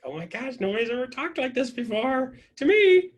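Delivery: rising to a high, loud finish; mock-awed, stretched-out climb in pitch; imitated amazement. Imitating Danielle's amazed reaction.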